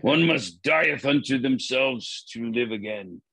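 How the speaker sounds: slow, grand Shakespearean actor. Retold or imitated. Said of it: "I was using my Shakespearean voice".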